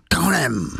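frenzied, slurred bark of an order; savage animal sound. A narrator's reading. Javert's frenzied order to Valjean, an animal-like snarl. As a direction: run it together into one wild animal cry. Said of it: "byl to zvířecí zvuk".

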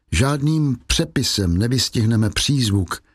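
plain reading voice

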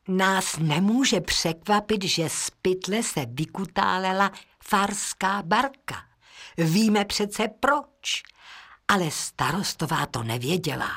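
ordinary delivery

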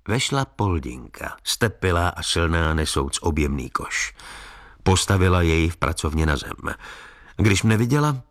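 ordinary delivery

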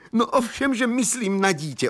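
high-pitched, worked-up reply; agitated insistence. A narrator's reading. Young man insists he means a real child, agitated. Distraught father answers in story.

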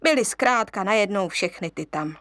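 ordinary delivery

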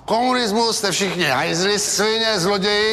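high, emphatic string of insults; defiant declaiming. Acted. Man shows what he thinks of communists, defiant tirade. Dared to say his opinion aloud.

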